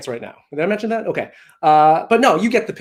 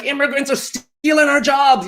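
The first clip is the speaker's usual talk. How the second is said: mock scream; 7 semitones higher than usual. Imitating people mad about immigrants, mock scream. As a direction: mimic an angry crowd in a raised pitch. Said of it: "people are screaming".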